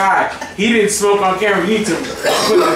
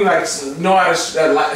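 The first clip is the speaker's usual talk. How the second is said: ordinary delivery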